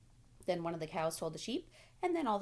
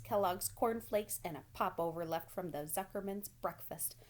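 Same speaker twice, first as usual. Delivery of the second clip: usual speaking voice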